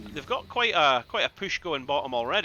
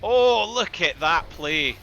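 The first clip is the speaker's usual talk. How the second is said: excited; 2 semitones higher, 8 dB louder, much slower than usual. Real, not acted. Caster reacts to a great play.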